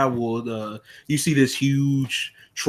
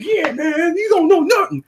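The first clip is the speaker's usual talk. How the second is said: much higher, taunting hype-man voice; hype man egging on; 5 dB louder than usual. Retold or imitated. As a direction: egg the big guy on from the side. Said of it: "like, hyping him".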